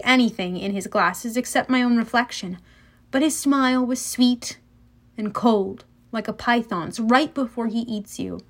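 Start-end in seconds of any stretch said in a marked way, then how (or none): none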